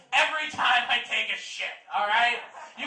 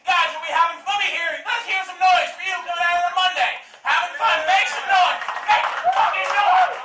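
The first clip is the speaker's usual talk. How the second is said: loud, higher hype-man yelling; comic hyping the audience, shouting; crowd hype shout; 7 semitones higher, 10 dB louder than usual. Real, not acted. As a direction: whip the room into a frenzy. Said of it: "hyping the crowd".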